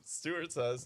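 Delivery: ordinary talk, nothing marked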